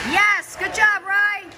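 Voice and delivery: loud high cheer from the stands; cheering from the sidelines. Real, not acted. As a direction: yell it out proudly to the player. Parent cheering at kids' game.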